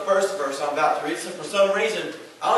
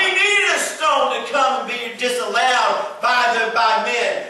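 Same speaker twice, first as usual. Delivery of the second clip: loud, high shouted preaching; 8 semitones higher, 5 dB louder than usual. Real, not acted. Pastor to congregation on sin, shouted preaching.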